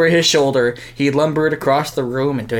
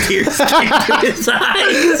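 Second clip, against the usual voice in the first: laughing; 9 semitones higher, slower than usual. Real, not acted. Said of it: "That should not be as funny as it is".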